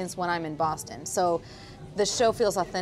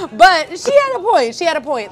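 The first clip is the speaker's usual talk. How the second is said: laughing through the words, louder and higher; 9 semitones higher, 12 dB louder than usual. Real, not acted. Admitting the panelist was right, still laughing hard. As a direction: concede it while still cracking up. Laughing while conceding the point.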